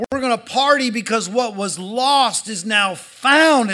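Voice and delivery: loud, high joyful proclamation; excited father. Acted. Father announcing his son's return to servants, jubilant. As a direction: shout the good news to the whole house. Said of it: "the dad's excited".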